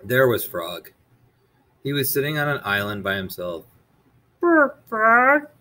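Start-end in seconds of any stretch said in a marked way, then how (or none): 4.4-5.5 high, sympathetic Toad; Toad's voice. Acted. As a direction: pity your friend in a high voice. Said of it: "said Toad".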